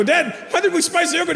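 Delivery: high-pitched son questioning dad; squeaky, exasperated question; protesting child. Retold or imitated. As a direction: imitate an aggrieved kid. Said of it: "I go".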